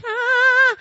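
high, loud frightened cry; sudden shriek of fear; scared yelp. Retold or imitated. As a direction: let out a panicked yelp. Scared, hanging from the ledge.

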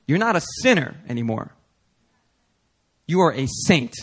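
usual speaking voice

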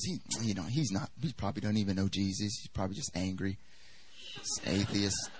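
low, soft aside to oneself; private thought. Retold or imitated. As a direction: drop right down, as if thinking aloud. Retells private reaction to a comment.

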